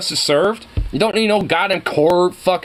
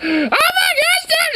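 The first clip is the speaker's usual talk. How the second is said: very high, slow laughing cry of disbelief; real high-pitched laughing disbelief; laughing shriek; slower than usual. Real, not acted. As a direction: squeal it through laughter. Reacting to video.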